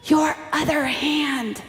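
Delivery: mother hissing at her daughter before the president; hissed. A narrator's reading. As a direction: hiss the fix at your daughter, urgent and scared. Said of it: "She hisses".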